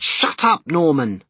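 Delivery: loud, high exasperated growl. A narrator's reading. Car passengers telling off whining Norman, growled. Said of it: "they growled".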